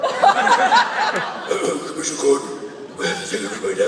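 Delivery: hammed-up superhero growl, loud; Batman. Actor mocking Batman addressing Commissioner Gordon, overdone growl. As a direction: overdo the gruff vigilante voice. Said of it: "Why is he talking like that?".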